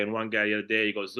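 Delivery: usual speaking voice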